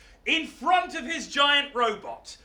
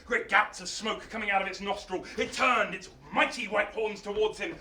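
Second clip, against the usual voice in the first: ordinary delivery, nothing marked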